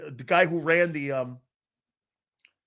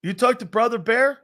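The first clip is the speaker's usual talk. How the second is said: retold surprise; 6 semitones higher than usual. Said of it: "I was like".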